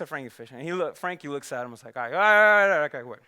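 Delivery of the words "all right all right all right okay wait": loud relenting. Acted. Bookie relents.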